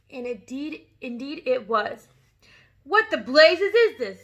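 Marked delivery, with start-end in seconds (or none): 2.8-4.2 loud, slow, higher yell. A narrator's reading. Headmistress yelling at the chalk, loud and slow. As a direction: bellow the question. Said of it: "yelled the Trunchbull".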